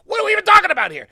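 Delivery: high, loud and fast disbelief; incredulous rant; exasperated outburst. Real, not acted. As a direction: fire it off fast and loud, fed up. Exasperated rant about pot laws.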